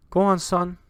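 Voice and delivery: a mother at the supper table; mother's voice. Acted. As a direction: urge your son to eat up. Game master voices the mother.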